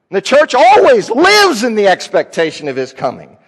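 usual speaking voice

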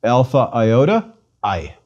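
Alpha iota said as eye; Greek diphthong. Shown as a demonstration. Teacher models the diphthong for repeating. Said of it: "Please repeat".

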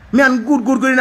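ordinary talk, nothing marked

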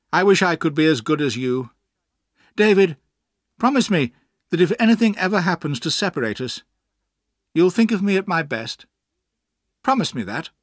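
lightly acted heartfelt appeal; earnest plea. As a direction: press for a promise before parting. Steerforth's earnest parting plea.

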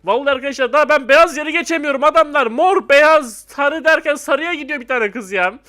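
loud, high exasperated complaint; loud griping at the game; frustrated rant. Real, not acted. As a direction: vent at the other players. Frustrated gamer ranting at players.

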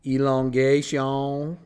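"elongation" drawn out slowly with a long final "shawn"; sounded out. Shown as a demonstration. Teacher writing step two, sounding out "elongation" slowly. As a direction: stretch each syllable of "elongation". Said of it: "It's just like how it sounds".

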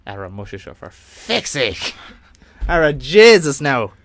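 slow, exaggerated Irish accent demo; Irish phrase lesson, slow and hammed-up Irish accent. Shown as a demonstration. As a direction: lay the Irish accent on thick, drawn out. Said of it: "if you're gonna do an Irish accent".

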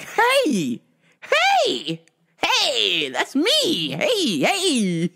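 high, gleeful hey hey; excited cheer. Retold or imitated. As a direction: cheer like you just won. Excited about fortune cookie.

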